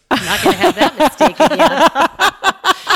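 loud, slow, laughing punchline. Real, not acted. Telling friends about the navy sheets, laughing. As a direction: stretch it out through laughter.

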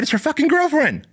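very high, exclaimed punchline; incredulous reveal. Retold or imitated. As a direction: land the twist in a high-pitched cry of disbelief. Reveals surprise attacker in story.

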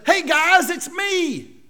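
high, light, cheery hello; casual reveal. Pretend. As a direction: Joseph revealing himself to his brothers, breezy and casual. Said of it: "If Joseph had just said".

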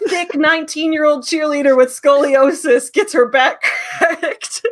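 high, loud title, breaking into laughter; laughing title read. Retold or imitated. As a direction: blurt the title and crack up. Reads absurd title, laughing.

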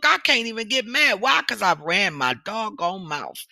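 usual speaking voice

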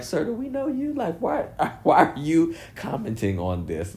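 high, incredulous "who are you" voice; mock disbelief. A viewer mocking a rapper who reappeared, with high incredulity. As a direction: raise the voice in a teasing what-are-you-doing-here.

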